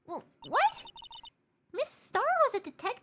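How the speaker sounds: surprised high judge voice; squeaky startled judge; high-pitched judge. Acted.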